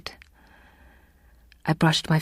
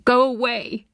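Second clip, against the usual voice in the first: angry; 8 semitones higher, 3 dB louder than usual. A narrator's reading. Patient sends visitor off.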